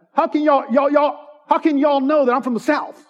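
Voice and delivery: hammed-up Southern US accent. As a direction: play an indignant Southerner saying y'all, in a Southern accent. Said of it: "this is the accent".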